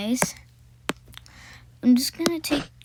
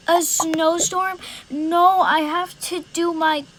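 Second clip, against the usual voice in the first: higher, louder startled reaction; mock shock; 5 semitones higher, 3 dB louder than usual. Kid hearing of a snowstorm, acted shock. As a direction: gasp out the news in disbelief.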